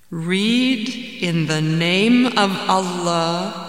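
very slow, solemn intoning; mysterious commanding voice. Acted. Voice in the cave recites to the man, solemnly commanding. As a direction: draw out each phrase as a resounding proclamation. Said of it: "And the voice said".

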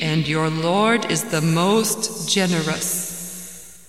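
very slow, solemn intoning; mysterious commanding voice. Acted. Voice in the cave recites to the man, solemnly commanding. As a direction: draw out each phrase as a resounding proclamation. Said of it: "And the voice said".

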